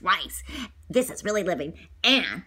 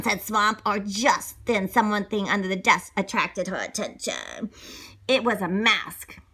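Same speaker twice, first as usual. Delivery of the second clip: usual speaking voice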